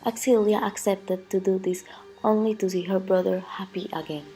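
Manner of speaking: ordinary delivery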